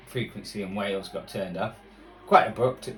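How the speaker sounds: usual speaking voice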